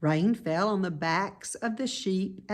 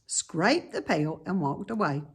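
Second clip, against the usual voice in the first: ordinary delivery, nothing marked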